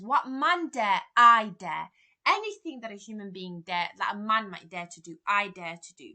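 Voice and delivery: loud, bold boast of courage; loud defiant Macbeth; defiant shout. Acted. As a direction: hurl the challenge at the ghost. Macbeth defies the ghost aloud.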